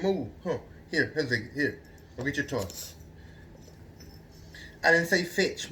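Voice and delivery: normal talking voice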